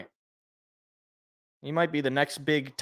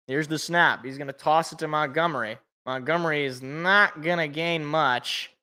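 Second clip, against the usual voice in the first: play-by-play. Acted. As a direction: narrate the play live like a TV announcer. Play-by-play of the drive.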